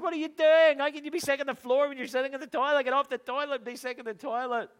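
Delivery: high, loud, fast exasperated yelling; retold angry shout. Dad shouting at his sick daughter in the bathroom, angry and loud. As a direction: bark at her as if just woken. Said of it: "I then shouted at her".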